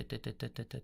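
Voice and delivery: ordinary talk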